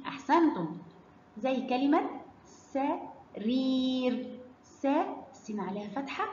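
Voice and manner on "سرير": very slow سرير, split into sa and a long reer; long vowel demo. Shown as a demonstration. Teacher models a madd word for pupils, drawn-out سرير. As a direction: stretch the yaa in سرير. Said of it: "هيبقى صوته إيه؟".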